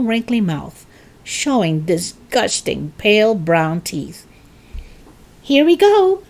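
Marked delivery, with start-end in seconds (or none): 5.4-6.2 high, loud eager call; crying out. A narrator's reading. George about to spoon in the medicine, crying out. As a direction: sing it out excitedly, pitched way up. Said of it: "George cried out".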